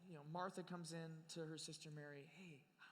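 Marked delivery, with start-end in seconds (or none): 2.4-2.9 hushed, higher, tentative; timid hushed question. Retold or imitated. Martha nervously telling sulking Mary Jesus came. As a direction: tiptoe into it nervously.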